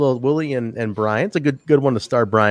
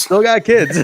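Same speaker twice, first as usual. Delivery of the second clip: mock promo line; 9 semitones higher, 5 dB louder, slower than usual. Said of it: "what does he say".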